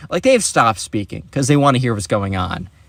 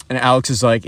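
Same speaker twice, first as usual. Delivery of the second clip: usual speaking voice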